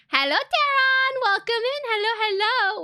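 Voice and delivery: high, loud, bubbly welcome; excited greeting. Real, not acted. Streamer welcoming an arriving viewer, excited greeting.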